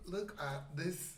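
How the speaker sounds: put-on slow British accent through laughter; attempted British accent, Siri-like. Actor reading a script line, badly faked British accent. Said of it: "That sound like Siri".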